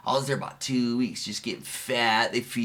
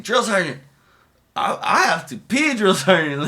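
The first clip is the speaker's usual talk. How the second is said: nervous recruit; 6 semitones higher, 5 dB louder than usual. Retold or imitated. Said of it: "I'm like".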